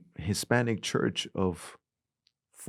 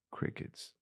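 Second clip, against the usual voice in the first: softly dropped, near whisper; hushed aside; 11 dB quieter than usual. Retold or imitated. Preacher describing congregation's silence, hushed. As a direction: let it fall into an awkward hush.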